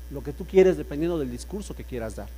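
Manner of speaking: ordinary talk, nothing marked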